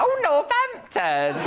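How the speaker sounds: high, slow, drawn-out answer; mock self-impression. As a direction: send up your own accent, stretching it out high. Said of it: "You're like".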